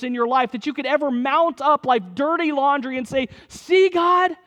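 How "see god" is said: high, loud mock indignant cry to God; mock protest. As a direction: throw it up at God in mock defiance. Mock sinner confronting God.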